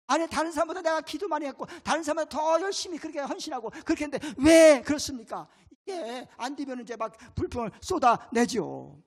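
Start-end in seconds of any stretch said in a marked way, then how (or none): 0.1-5.5 complaint building up. Acted. Believer complaining to God, fast and aggrieved. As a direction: rattle off grievances then burst out.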